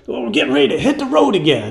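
lightly hammed Buddy on the phone; imitating [name]. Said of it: "He's like".

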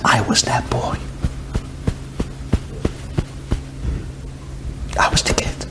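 whispered, the secret finally out; a hushed admission of guilt; hushed confession. Acted. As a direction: let it slip out barely above a breath.

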